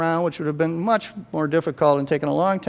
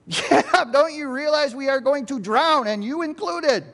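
high-pitched, agitated wake-up cry; emotional urgent plea; 9 semitones higher than usual. Acted. Panicked disciple waking Jesus in the boat, urgent and high. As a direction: plead urgently, starting with a shout. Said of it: "How much emotion is in the disciples' voice".

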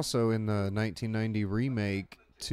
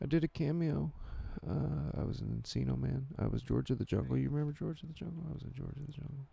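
higher, meek impression, fading softer; Brendan Fraser; 6 semitones higher, 3 dB quieter than usual. Forgotten star recalling George of the Jungle, high and meek. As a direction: plead to be remembered, hesitantly. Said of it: "he, like, talks like this into the mic".

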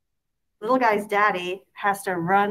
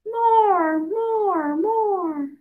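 high, loud, gleeful "more, more, more"; excited baby; 11 semitones higher, 4 dB louder, much slower than usual. A narrator's reading. Baby squealing at daddy for more swinging. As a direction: squeal it like a delighted toddler.

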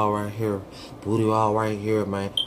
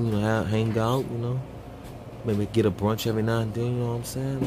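low, soft smooth-talking voice; smooth-talker voice; 4 semitones lower, 6 dB quieter than usual. Acted. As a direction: play it cool and smooth. Acting smooth bachelor.

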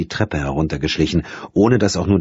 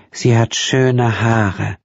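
put-on slow, higher, simple child voice; childlike voice; 6 semitones higher, much slower than usual. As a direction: say it slowly like a child. Childlike daughter.

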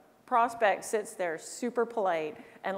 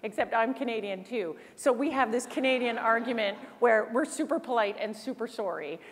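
ordinary talk, nothing marked

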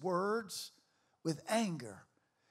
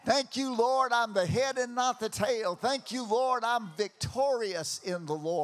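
loud, rising declarations of faith; fiery preaching; 3 semitones higher, 6 dB louder than usual. Acted. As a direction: build the congregation up. Preaching at full force.

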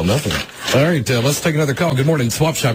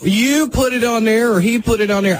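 heated defensive yell; 12 semitones higher than usual. Acted. Host arguing with the angry wife on air, higher heated yell. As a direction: shout him down and refuse blame.

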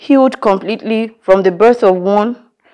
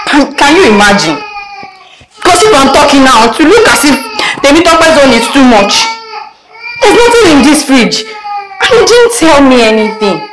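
sobbing and shouting, higher and louder; crying outburst; 7 semitones higher, 4 dB louder than usual. Acted. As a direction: break down in tears while yelling.

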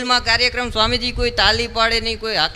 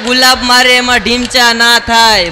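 louder, slow, incredulous retort; loud disbelief; 2 semitones higher, 8 dB louder than usual. Retold or imitated. Telling a friend a rose can't cause a bump, loud and slow disbelief. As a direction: spell it out as if it's obvious nonsense.

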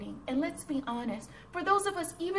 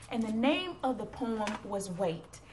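usual speaking voice

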